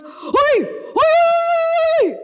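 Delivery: the game ranger yells at the charging rhino to scare it off; ranger's shout. Retold or imitated.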